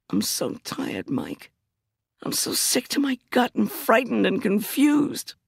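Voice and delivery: so tired she can barely voice it; tired and frightened. Acted. Exhausted, frightened narrator voicing herself.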